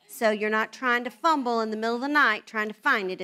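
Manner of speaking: normal talking voice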